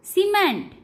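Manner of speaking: सीमेंट starting on स, pitched high. Shown as a demonstration. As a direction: say सीमेंट high with an initial s sound. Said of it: "स के रूप में होता है".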